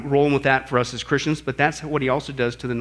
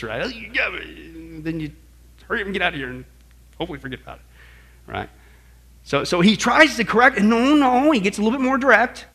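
normal talking voice

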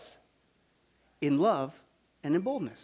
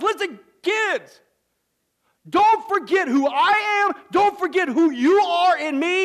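slow, high, loud tender plea; voicing God; 6 semitones higher, 5 dB louder, slower than usual. God telling His kids not to forget, slow and loud. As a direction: speak for God slowly to His children. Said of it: "He's trying to tell us".

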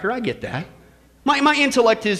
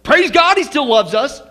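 loud, higher-pitched mock protest; hammed-up, defensive praise-God attitude; dismissive churchgoer; 3 semitones higher, 6 dB louder than usual. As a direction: brush it off as a self-excusing churchgoer. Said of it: "what's our attitude in the church".

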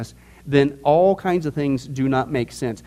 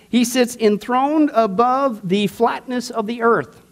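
mock misreading; 7 semitones higher, slower than usual. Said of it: "Oh, I'm sorry. That's not what it says".

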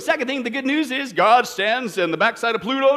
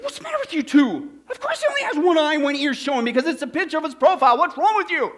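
high-pitched, exasperated mock outburst; angry police officer; 6 semitones higher, 3 dB louder than usual. Police officer scolding two clueless trainees, mock angry. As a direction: scold them in comic disbelief. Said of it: "the police officer in anger".

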